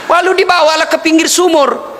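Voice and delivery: usual speaking voice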